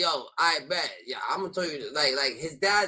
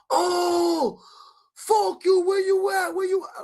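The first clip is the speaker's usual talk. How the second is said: put-on drunk yelling; 13 semitones higher, 4 dB louder, slower than usual.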